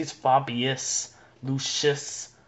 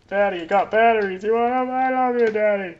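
put-on high, loud kid climbing higher; eager child voice; 8 semitones higher, 4 dB louder than usual. Little kid offering Daddy batteries, high and eager. Plays Lucius pleading to Daddy.

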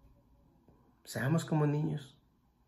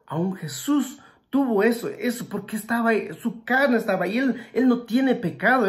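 raised, high and loud preacher's voice; fervent preaching; 6 semitones higher, 4 dB louder than usual. Acted. Preacher stirring the congregation about Jesus's fear, fervent and loud. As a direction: drive the point home to the congregation.

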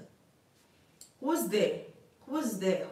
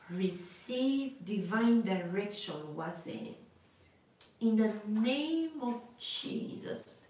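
slow prophetic declaration; slower than usual. Acted.

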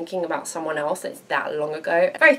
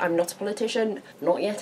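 ordinary talk

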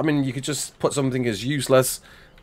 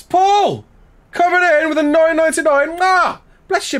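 very high, loud hype call; excited shout-out; 5 dB louder than usual. Real, not acted. Streamer hails a donor coming in, high excited shout. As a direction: shout the donor's name in delight.

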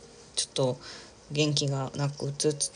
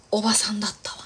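whispered aside; 4 semitones higher, faster than usual. Real, not acted. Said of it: "whispered aside".

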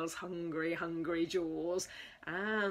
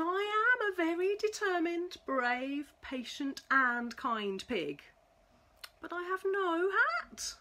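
higher, brighter proud declaration; proud pig; 7 semitones higher than usual. Acted. Pig proudly telling the mouse he is kind, in a higher voice. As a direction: announce it proudly then admit no hat.

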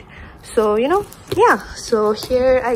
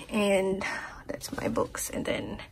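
whisper; 12 dB quieter, slower than usual. Real, not acted. Whispered aside at shelves.

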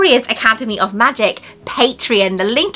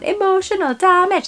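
high-pitched mimicry in comic accent; imitated catchphrase; 9 semitones higher than usual. Woman quoting partner's character fondly, high comic imitation. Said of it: "he's brilliant character".

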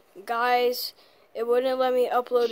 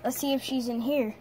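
ordinary talk, nothing marked

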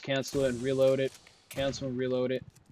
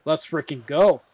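thrilled; 2 semitones higher, 11 dB louder than usual. Real, not acted. Gamer just handed a great weapon, thrilled. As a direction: burst out celebrating. Gets the weapon wanted from the box.

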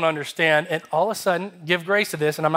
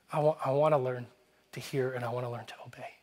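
soft, low repentant prayer; humble, hushed plea; 4 semitones lower, 9 dB quieter than usual. Retold or imitated. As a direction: act out a humbled promise, very softly. Retold repentant prayer to God.

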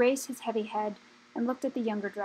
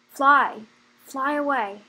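a sudden loud burst, pushing them off; old dragon shouting at young dragons to flee; urgent shout; 3 semitones higher, 9 dB louder, much slower than usual. A narrator's reading.

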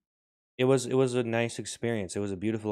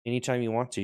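ordinary talk, nothing marked

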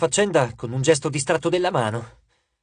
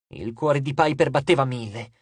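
plain reading voice

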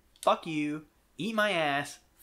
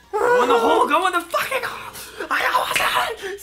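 high-pitched shouted outburst; exasperated gamer shout; frustrated yelling; 5 dB louder than usual. Real, not acted. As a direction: yell at the game, voice jumping high.

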